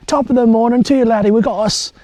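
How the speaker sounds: loud, high-pitched hammed-up Irish; attempted Irish accent. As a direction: put on a bad, boisterous Irish accent. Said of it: "I should actually do an Irish accent".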